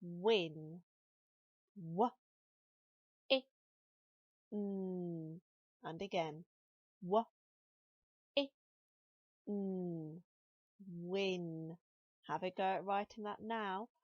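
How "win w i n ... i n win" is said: "W, I, N" sounded out letter by letter, slow and clear; teacher segments "Win" for children, letter by letter; sounding out letters. Shown as a demonstration. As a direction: sound out "Win" one letter at a time, then blend it. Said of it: "Let's see if we can sound that out together".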